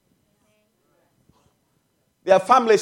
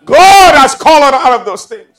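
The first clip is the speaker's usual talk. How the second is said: loud, raised scripture proclamation; full-force preaching; 5 semitones higher, 9 dB louder than usual. Real, not acted. Preacher retelling God's command to Abraham, full-force preaching. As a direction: ring out the command from the pulpit.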